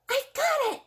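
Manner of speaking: the snail suddenly has an idea; snail cries out. Acted. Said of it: "she cried".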